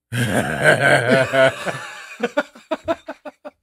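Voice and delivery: loud imitated devilish cackle; long, loud, mischievous mock laugh. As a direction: cackle like a naughty kid caught out. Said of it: "he starts this devilish shit laugh".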